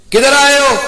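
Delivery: sharp, high, loud demand from an angry uncle; stern uncle shouting down at the boy. Acted. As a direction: bark it at the nephew like a furious uncle. Uncle confronts his nephew.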